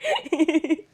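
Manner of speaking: sorry through real giggles; giggle, then apologise; laughing apology. Real, not acted. Presenter spoils a take, apologising with a laugh.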